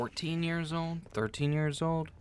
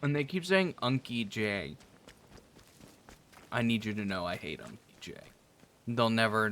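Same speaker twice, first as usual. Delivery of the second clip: ordinary delivery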